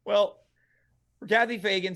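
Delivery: usual speaking voice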